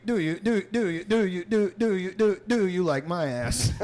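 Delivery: stuttering skipping robot voice; glitching robot. Pretend. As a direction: repeat like a glitching machine.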